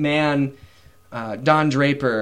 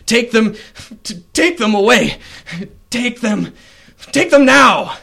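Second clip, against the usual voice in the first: much higher, louder repeated command; commanding order; 9 semitones higher, 7 dB louder than usual. Acted. Captain to his guards, barking the order. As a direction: order the guards, rising to a shout.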